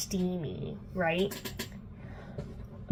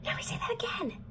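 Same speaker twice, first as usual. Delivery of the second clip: quicker, higher hissed whisper; furious whisper; 13 semitones higher, 7 dB quieter, faster than usual. Retold or imitated. Alec repeats his warning to Clary, sharper furious whisper. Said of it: "like, really mad and grabs her".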